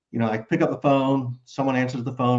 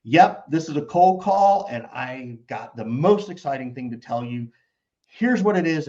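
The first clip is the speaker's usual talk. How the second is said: excited; 3 semitones higher than usual. Shown as a demonstration. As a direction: pitch it like big news. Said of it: "You can also just say".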